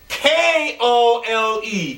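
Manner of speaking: spells it out slowly through a laugh; slow, giggly letter-by-letter; laughing spelling. Real, not acted. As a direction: laugh while you spell each letter. Laughing while correcting a spelling.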